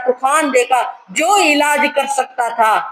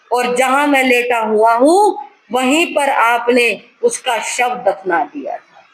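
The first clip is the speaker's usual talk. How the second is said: ordinary talk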